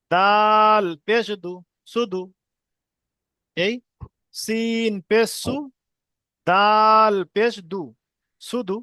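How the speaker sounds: slow drill with long-held letter names; deliberate, chant-like spelling aloud; spelling piece by piece. Shown as a demonstration. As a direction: stretch each letter, pause, then blend the syllables. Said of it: "কেটে কেটে বানান করবো".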